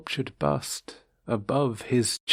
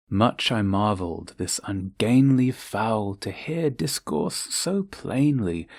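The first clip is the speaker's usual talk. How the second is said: ordinary delivery, nothing marked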